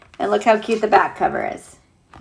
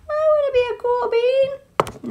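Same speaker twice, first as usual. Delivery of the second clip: little bean voice. Pretend. Bean character wishing to be cool.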